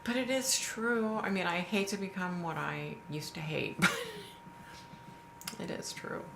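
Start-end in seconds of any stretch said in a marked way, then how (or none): 3.8-6.2 laughing admission. Real, not acted.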